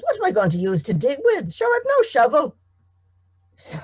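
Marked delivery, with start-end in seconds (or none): 0.0-2.6 much higher and louder, outraged protest; indignant farmer. Acted. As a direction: throw up your hands at the silly idea. Tom indignant, no shovel.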